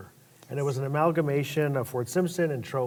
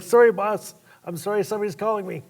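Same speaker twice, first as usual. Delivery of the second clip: raised, hurried apology to the boss; flustered apology; 6 semitones higher, 5 dB louder than usual. Retold or imitated. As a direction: blurt out sorry to the boss, fast.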